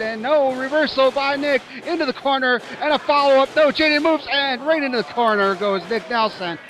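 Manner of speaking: high, loud rapid-fire call; excited play-by-play. Real, not acted. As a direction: shout the action as it happens. Fast reversal sequence called.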